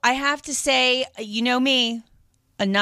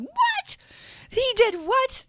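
high shriek of disbelief, much higher; shocked disbelief; slower than usual. Retold or imitated. Jumping off the couch at the game, shrieking in disbelief. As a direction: squeal the question, can't believe it.

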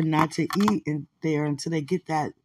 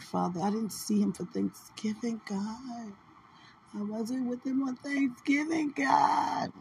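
pleading to God, rising higher as it breaks; a tearful plea that climbs in pitch and ends in a cry of "God"; retold tearful prayer; 4 semitones higher, 8 dB quieter than usual. Telling God she missed him at Thanksgiving, rising tearful plea. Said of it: "I was crying".

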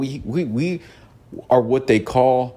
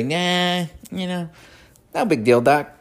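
a loose attempt at a cool cartoon rabbit, pitched higher; Bugs Bunny; 9 semitones higher than usual. Bugs Bunny shrugging off a hostile character, mock voice. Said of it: "I can't duplicate his voice".

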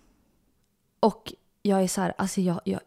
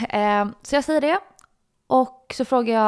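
ordinary talk

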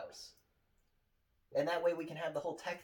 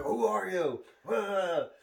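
raised, hard demand; menacing action hero; 5 semitones higher, 5 dB louder, much slower than usual. Retold or imitated. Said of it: "and he's like".

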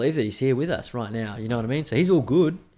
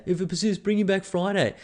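high, airy brush-off as the doctor; lightly mock the doctor's lazy advice, pitched up; pitched-up doctor voice sending the patient home; 9 semitones higher than usual. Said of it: "He's going".

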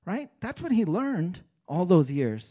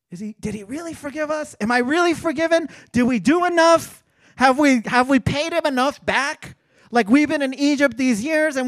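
higher, louder and fast, fretting; anxious brothers; 8 semitones higher, 8 dB louder than usual. Retold or imitated. The guilty brothers fretting over forgiveness, anxious and loud. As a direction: worry it out in a rush.

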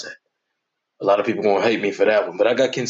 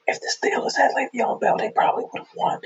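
whispered, much softer, like sharing a secret; mock secret whisper; 13 semitones higher, 3 dB quieter than usual. Host whispering a secret to his listeners. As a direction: lean in and whisper it. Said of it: "I'll tell you a secret".

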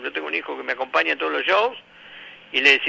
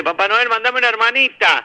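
put-on higher kid voice asking; lightly voiced little boy begging; a hint of a pleading child; child's plea; 8 semitones higher than usual. Child writing to Santa.

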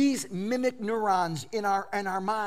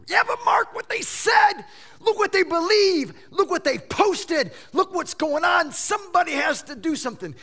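high, loud indignant protest; raised voice, urgent and indignant; agitated objector; 11 semitones higher, 7 dB louder than usual. Acted. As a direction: play a worked-up objector shouting back. Mimics agitated objectors to gentle answer.

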